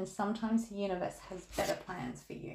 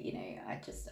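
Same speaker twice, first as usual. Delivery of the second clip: breathy whispered aside, much softer; 12 semitones lower, 10 dB quieter than usual. Retold or imitated. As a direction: drop to a whisper mid-apology. Retold awkward apology to girl.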